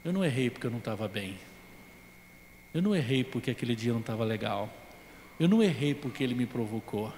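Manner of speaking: very soft, low inner voice; inner confession. Retold or imitated. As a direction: murmur it as a private realisation.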